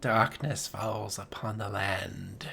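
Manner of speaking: low, slow, hushed horror narrator; Vincent Price. Acted. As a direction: intone it softly like a spooky narrator. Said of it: "Vincent Price narrating the thing".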